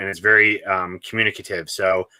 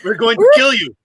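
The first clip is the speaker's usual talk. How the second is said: high, loud mock threat; hammed-up battle yell; yelled insult; 13 semitones higher, 7 dB louder than usual. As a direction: imitate a movie brawler yelling. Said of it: "they'll yell an insult".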